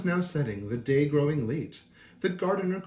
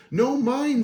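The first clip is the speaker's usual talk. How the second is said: high, louder sharp retort; snapping beetle; 8 semitones higher, 6 dB louder than usual. A narrator's reading. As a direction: bite the words off like a cross beetle. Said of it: "snapped the beetle".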